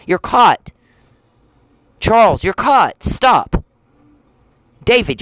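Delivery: high, loud accusation; angry yelling. Real, not acted. Calling out Charles and David, high angry yelling. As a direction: yell it at them, fed up.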